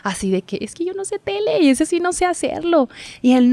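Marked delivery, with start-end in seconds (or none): 0.6-2.9 pitched way up, nervous and unsure; nervous high protest. Retold or imitated. Telling her cameraman she can't do TV, nervous high voice. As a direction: squeak it out like a scared rookie. Nervous self quoted to mentor.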